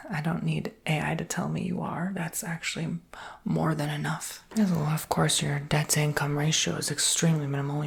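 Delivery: ordinary talk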